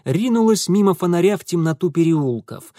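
plain reading voice

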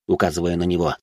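plain reading voice

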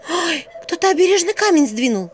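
high, loud startled gasp; startled cry. Acted. Vasilisa finds a moved stone on the road, startled. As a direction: yelp it out in alarm.

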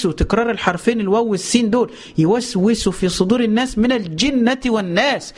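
ordinary talk

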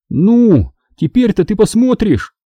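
high hopeful question. A narrator's reading. Boy hopefully asks father to look, high hopeful question. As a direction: ask with hope in the voice. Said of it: "с надеждой спросил я".

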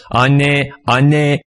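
a touch of a group calling out together. A narrator's reading. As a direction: call out for mother, lightly. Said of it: "diye seslendiler".

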